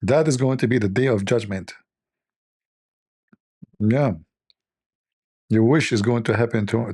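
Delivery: ordinary delivery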